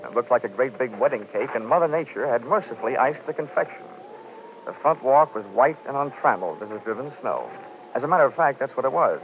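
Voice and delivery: wry detective voiceover, over music; hardboiled narration. A narrator's reading. Private eye recounting the case in a hardboiled narration. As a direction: tell it to the listener like a case report.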